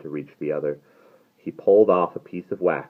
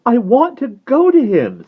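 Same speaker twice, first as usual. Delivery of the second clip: high, loud, yearning cry; high, insistent plea; pleading girl; 12 dB louder than usual. A narrator's reading. Ariel pleads to go to him.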